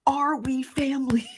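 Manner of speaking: high, breathy stage whisper; playful whisper. Acted. Host whispers to her viewers, asking if they are family. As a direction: whisper it like a secret. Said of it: "playful question to chat".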